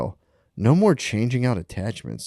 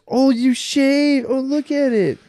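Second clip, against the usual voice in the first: high, slow, cooing wife voice; wife admiring a fresh shave, high and slow; mock wife; 13 semitones higher, slower than usual. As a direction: coo it like an impressed wife. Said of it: "my wife goes".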